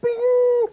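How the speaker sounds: a sharp, high, loud ding imitation; high bright ding sound effect; high loud microwave bing. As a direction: squeal out the microwave bell. Microwave bell.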